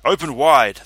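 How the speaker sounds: loud, commanding call; wrangler's call. A narrator's reading. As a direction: call it out to the crowd. Wrangler calling to crowd.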